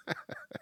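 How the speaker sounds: a short airy laugh; breathy high giggle. Real, not acted. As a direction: laugh quietly at yourself. Laughs at own rant.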